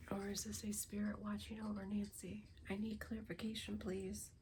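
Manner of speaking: ordinary talk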